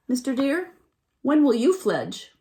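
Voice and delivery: loud chirpy question; baby bird asking. A narrator's reading. Baby bird asking the deer when he'll fledge, loud. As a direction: ask the deer brightly.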